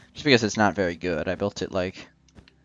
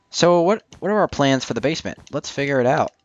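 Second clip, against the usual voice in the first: higher, louder upbeat push forward; energetic restart; 7 semitones higher, 5 dB louder than usual. Real, not acted. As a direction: pump up the energy for the next plan.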